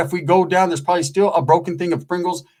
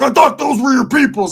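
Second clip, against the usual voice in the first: Roach's retort; 9 semitones higher, 6 dB louder than usual. Retold or imitated. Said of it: "And he was like".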